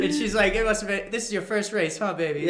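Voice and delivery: high, loud teasing Southern cop voice; a policewoman teasing a struggling rookie; laughing cop. Retold or imitated. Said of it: "She said".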